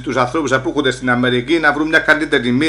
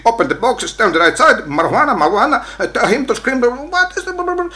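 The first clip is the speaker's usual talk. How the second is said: μίμηση αστυνόμου; 8 semitones higher than usual. Retold or imitated. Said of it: "να μας λέουσει".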